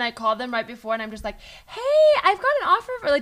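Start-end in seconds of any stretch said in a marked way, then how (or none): 1.7-3.1 high, bright voicemail pitch; mock upbeat caller. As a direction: sell the good news like a cheery caller. Mock encouraging voicemail before an interview.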